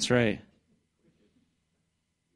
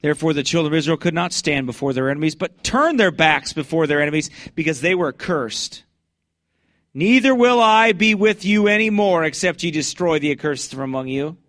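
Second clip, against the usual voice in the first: loud, high street preaching; full-force preaching; 6 semitones higher, 5 dB louder than usual. Acted. Street preacher thunders scripture at passersby, loud and high.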